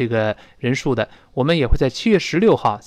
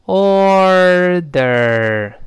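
"order" stretched, louder, each r held; slow practice; 3 semitones higher, 5 dB louder than usual. Shown as a demonstration. Teacher showing how to start practising, very slow. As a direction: draw out "order" in two long syllables. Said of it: "我先这么说".

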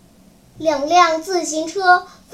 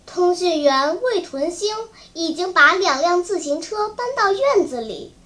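usual speaking voice

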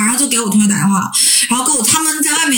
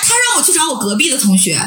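ordinary talk, nothing marked

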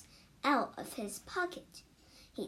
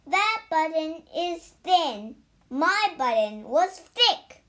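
louder, insistent storybook shout; still shouting; 4 semitones higher, 9 dB louder than usual. A narrator's reading. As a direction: keep shouting the complaint. Toad shouting at Frog.

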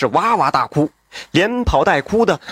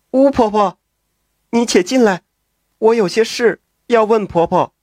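calling out; 5 semitones higher than usual. A narrator's reading. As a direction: call the old neighbour back urgently. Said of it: "潘氏急忙开口喊道".

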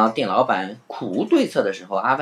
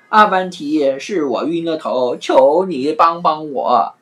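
mild frightened plea, very slow at the end; begging for mercy; 6 semitones higher, 5 dB louder, slower than usual. Acted. Innkeeper kneeling to Afanti, mild begging. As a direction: kneel and beg. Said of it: "吓得扑通跪地求饶".

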